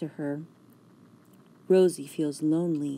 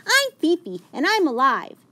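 very high, fast, louder chatter; small yippy dog; 10 semitones higher, 6 dB louder than usual. A narrator's reading. Fifi introduces herself, very high and quick. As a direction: chatter proudly in a tiny voice. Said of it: "holds her tiny head up high".

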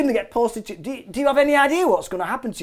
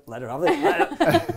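slow, laughing; 5 semitones higher, 4 dB quieter, much slower than usual. Retold or imitated. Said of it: "I went".

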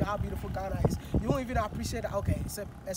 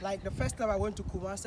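ordinary delivery

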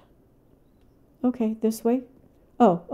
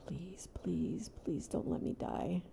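breathless whispered begging; hushed prayer not to die; whispered plea; 2 semitones lower, 18 dB quieter than usual. Real, not acted. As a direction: beg it under your breath.

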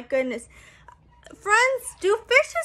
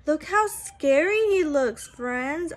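plain reading voice

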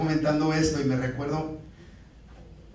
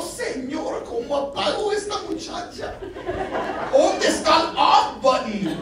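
mock prayer; 10 semitones higher than usual. Mock prayer about wife.

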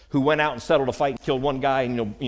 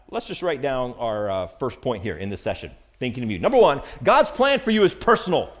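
ordinary talk

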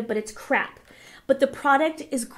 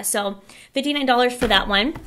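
usual speaking voice

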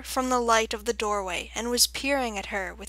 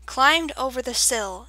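ordinary delivery

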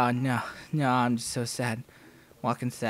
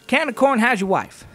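louder, higher blurted line; kid blurting out; 5 semitones higher, 8 dB louder than usual. Retold or imitated. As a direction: spit it out in one nervous rush. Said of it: "he goes".

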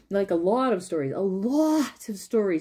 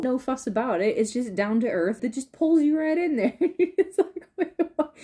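ordinary talk

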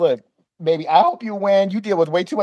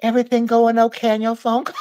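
higher, acted careful question; careful polite inquiry; 4 semitones higher than usual. Eavesdropper peeking round at the clerk, mock careful politeness. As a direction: gingerly check in, pitched up.

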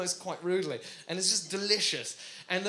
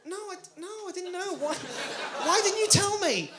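plaintive comic squeal, rising in volume; high-pitched protest; 9 semitones higher than usual. Acted. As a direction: protest high, building to an outburst. Acted protest to his friend.

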